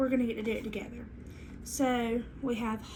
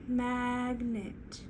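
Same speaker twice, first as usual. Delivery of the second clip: "Magnet" pulled apart as MAG... NET; slow two-part "Magnet"; stretched into syllables; 3 dB quieter than usual. Shown as a demonstration. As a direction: draw out both halves of "Magnet". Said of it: "Let's try it again".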